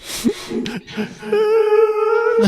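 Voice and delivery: long high falsetto cry; sustained high keening sob; high wailing sob. Acted. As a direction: wail like a broken-hearted mother. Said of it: "She's crying so much".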